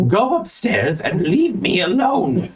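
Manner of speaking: high, slow dismissal; acted high, strained plea for solitude; exasperated library dweller. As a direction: drag out the order, fed up. He says.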